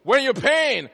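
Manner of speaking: loud, pushy demand for payment; demanding school voice. Retold or imitated. School office demanding its fee, loud and pushy. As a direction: press him hard for the money.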